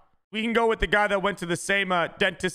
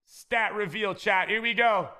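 ordinary talk, nothing marked

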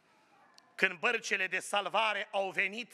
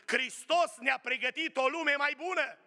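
full-force preaching; 7 semitones higher than usual. Acted. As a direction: lift the crowd with each phrase. (predică înflăcărată, mai sus și mai tare)

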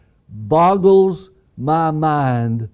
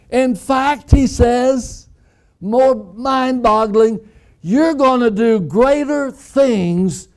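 higher, rising with wonder; excited preaching; 8 semitones higher than usual. Acted. Preacher marveling at Jesus' promise to the church, excited. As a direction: lift it up in amazement.

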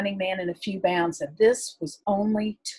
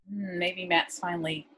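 ordinary delivery, nothing marked

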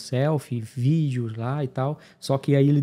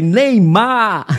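higher and louder, a proud one-word pitch; excited answer; 8 semitones higher, 9 dB louder than usual. Retold or imitated. Traveller charming the border officer, loud eager "Neymar". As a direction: sell it like a football fan. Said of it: "Aí eu falei".

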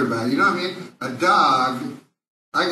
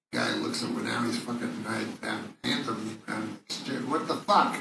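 muttered aside; 4 semitones lower, 8 dB quieter than usual. Real, not acted.

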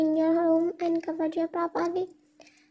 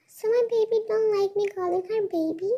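ordinary delivery

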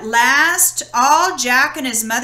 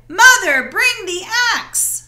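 much higher, louder shout; urgent cry; 8 semitones higher, 6 dB louder than usual. Acted. Jack shouting to his mother from the beanstalk, urgent cry. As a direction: call down for help in a panic. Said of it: "he cried".

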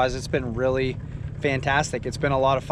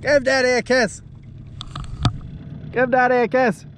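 playful coaxing; 10 semitones higher, 4 dB louder than usual. Pretend. Coaxing a fish to bite.

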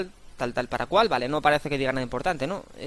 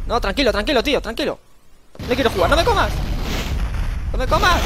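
higher and higher, pleading in fake terror; mock panic; 7 semitones higher, 4 dB louder than usual. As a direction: beg the dinosaur, voice climbing. Begging the T-Rex not to eat him.

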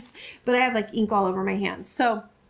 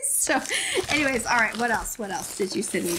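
ordinary delivery

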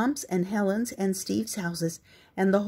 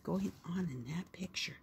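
whispering; 13 dB quieter than usual. Real, not acted. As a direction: whisper it like a secret. Aside to listening child.

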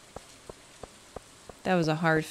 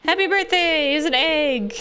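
excited; 9 semitones higher, 4 dB louder than usual. Acted. Cheerful greeting in game.

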